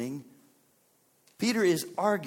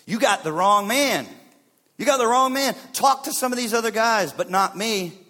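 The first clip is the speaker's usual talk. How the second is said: loud, raised-pitch objection; indignant protest; 5 semitones higher, 8 dB louder than usual. Acted. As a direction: protest your innocence forcefully. Peter protesting to Jesus.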